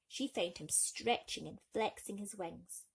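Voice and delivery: plain reading voice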